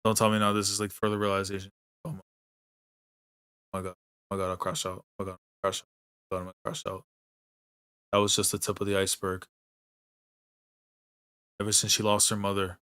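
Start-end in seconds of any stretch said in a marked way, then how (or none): 2.0-7.0 a real low hushed groan of despair, near breaking; quiet despair. Real, not acted. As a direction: mutter it to yourself, crushed by the scene. Overwhelmed by anime scene.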